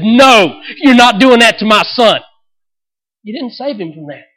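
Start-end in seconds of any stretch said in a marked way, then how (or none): 0.0-2.2 loud, protective refusal; a father's loud no to harm; protective shout. Retold or imitated. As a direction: bellow it like a father shielding his son. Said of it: "God did not step in and said".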